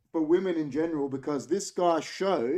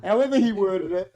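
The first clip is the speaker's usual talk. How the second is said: laughing; 3 semitones higher, 7 dB louder than usual. Real, not acted. Laughing at the inquest claim.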